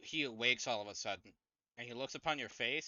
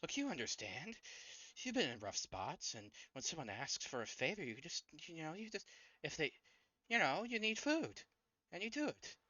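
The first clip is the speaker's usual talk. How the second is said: halting plea climbing higher in pitch; pleading cornered man; 7 semitones higher than usual. Acted. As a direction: plead for understanding, faltering mid-thought. Voices cornered man pleading his case.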